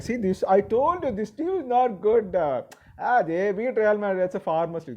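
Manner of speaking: high, gloating I-told-you-so voice; mocking smug pundits. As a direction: parody the pundits crowing over a slip-up. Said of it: "They'll start talking like this".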